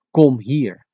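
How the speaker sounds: mildly commanding, short order; harsh command. Shown as a demonstration. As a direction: order the child over. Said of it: "the harsh commanding method of saying".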